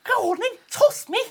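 high Scouse dwarf voice; squeaky Liverpool accent; Liverpudlian accent. Pretend. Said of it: "Is that Liverpudlian?".

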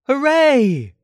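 high, loud storybook cheer; shout. A narrator's reading. Christopher Robin cheers as Pooh floats up, high shout. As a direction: cheer it out with a falling swoop. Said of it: "you shouted".

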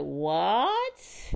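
drawn-out, rising "what?"; retold stunned reaction, stretched into a question; shocked disbelief. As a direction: stretch it out, climbing at the end. Retelling friends' stunned reaction.

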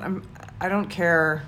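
ordinary talk

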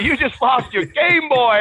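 high, loud, drawn-out gloat; gleeful taunting shout. Retold or imitated. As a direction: crow it like the winner rubbing it in. Teasing announcement of a lost bet.

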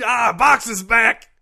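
loud, high burst-in announcement; hammed-up yell after a spoken crash noise; mock triumphant shout. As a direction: crash into the sponsor read shouting. Shouted gag in sponsor read.

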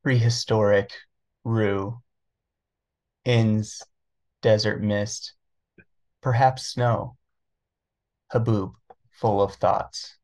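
slow, halting reading with long pauses; pretentious poet voice. Shown as a demonstration. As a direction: hang on each line like a self-serious poet. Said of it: "really pretentious poet voice".

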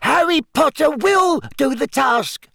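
high squeaky elf voice; squeaky house-elf. A narrator's reading. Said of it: "squeaked the elf".

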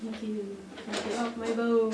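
gruff dismissal, quietly; ominous ferryman. Acted. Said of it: "I was trying to be ominous".

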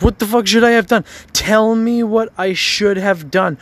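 high, loud, frustrated demand; exasperated outburst. Real, not acted. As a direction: throw the question back at her angrily.

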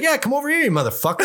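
high-pitched retold fighter's taunt; mock challenge. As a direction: imitate a fighter goading his rival. Retells fighters' promo quarrel.

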